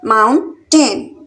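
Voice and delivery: Mountain slowly in two separate beats; split into syllables. Shown as a demonstration. Teacher counting out two syllables for learners, syllable by syllable. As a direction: pause between mount and टेन. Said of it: "mountains में टू syllable".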